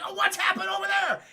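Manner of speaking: high, loud fake alarm; hammed-up startled shout; mock surprise. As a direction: cry out as a diversion. Acted ruse to distract opponent.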